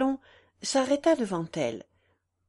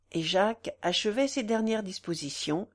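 plain reading voice

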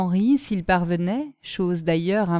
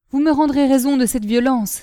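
raised, indignant outcry; exclaiming; 5 semitones higher, 6 dB louder than usual. A narrator's reading. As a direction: cry out the demand. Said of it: "s'écria".

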